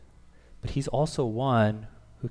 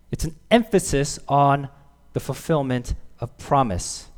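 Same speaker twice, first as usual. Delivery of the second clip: ordinary talk, nothing marked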